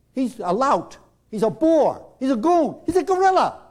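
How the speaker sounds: building insults. Retold or imitated. Group piling on Rob, building to a shout. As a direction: pile it on harder each time.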